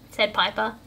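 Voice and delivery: ordinary delivery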